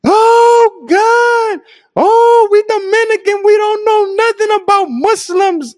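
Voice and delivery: shrill mock wail of grief, slowing at the end; mother wailing. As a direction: imitate a distraught mother wailing in the visit room. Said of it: "starts wailing, crying".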